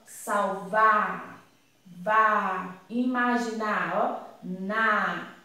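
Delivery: SALVAR then a held "vá", IMAGINAR then a held "na"; final syllables isolated. Shown as a demonstration. Teacher pointing out the rhyming syllables, slow isolated endings. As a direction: say each word, then echo its last syllable alone, drawn out. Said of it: "Olha aqui".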